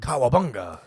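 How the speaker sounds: low, soft mock Japanese "cowabunga"; mock Japanese accent. Shown as a demonstration. Comic arguing it could be Japanese, low mock Japanese "cowabunga". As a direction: drop "cowabunga" deep, as if Japanese. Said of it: "cowabunga can be".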